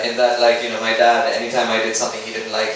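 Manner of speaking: ordinary talk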